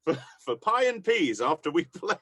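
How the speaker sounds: mock announcement. Mock stage announcement to crowd.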